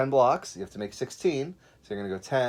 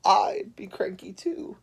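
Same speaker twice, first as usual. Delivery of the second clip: whispered aside; 8 semitones higher, 4 dB louder, slower than usual. Acted. Said Zebra.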